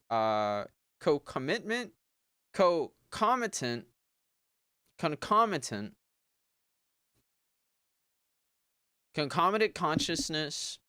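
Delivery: "concomitant" fumbled then said as kən-KOM-i-tənt; stumbling on word. Shown as a demonstration. Fighting a hard word mid-reading. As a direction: work through "concomitant" syllable by syllable. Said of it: "I swear I can say it".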